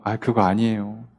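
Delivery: low-pitched, understated objection; calm wise person. Acted. Wise advisers objecting to policy, calm and low. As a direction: object mildly in a low, even voice. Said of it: "아무리 지혜로운 사람들이요".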